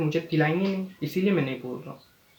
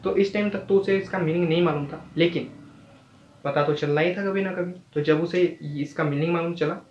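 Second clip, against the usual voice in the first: ordinary delivery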